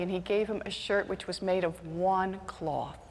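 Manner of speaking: usual speaking voice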